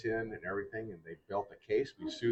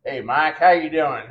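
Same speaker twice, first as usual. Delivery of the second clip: retold hail, pitched up and loud; hearty greeting; 6 semitones higher, 11 dB louder than usual. Mel Wright greets the pitcher as he walks up, hearty hail. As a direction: call out a hello, higher and louder.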